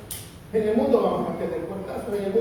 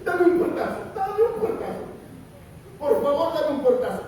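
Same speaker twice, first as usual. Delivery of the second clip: higher pleading father, half-laughing; begging father; 7 semitones higher than usual. Retold or imitated. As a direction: beg it like a guilty dad asking for a slam. Father begging son, retold. (padre culpable suplicando, agudo y medio riendo)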